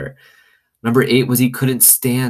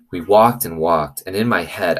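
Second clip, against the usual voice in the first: ordinary delivery, nothing marked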